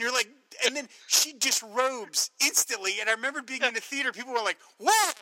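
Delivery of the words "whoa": loud high gasp of shock; a crowd caught off guard, whooping; shocked whoa. Retold or imitated. Moviegoers stunned by a sudden nude scene, loud high whoa. Audience gasping in the theater.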